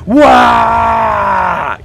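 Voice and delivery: loud, high mock orc battle yell; orc war cry. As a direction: let out a hammed-up orc charge cry. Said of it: "It's like".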